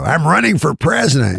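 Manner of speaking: mock-announce it as an old man; Mickey Rooney. Old star announcing a candidacy, lightly imitated proclaiming. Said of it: "so he talks super loud".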